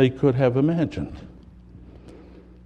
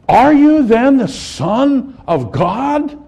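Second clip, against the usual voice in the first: incredulous questioning; 10 semitones higher, 4 dB louder, much slower than usual. Acted. Leaders question Jesus at trial.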